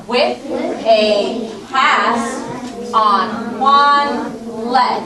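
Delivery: every word drawn out very slowly; slow read-aloud. Shown as a demonstration. Teacher reading the story to young pupils, very slow and clear. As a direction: read it slow so the kids can follow along. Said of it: "Let's read".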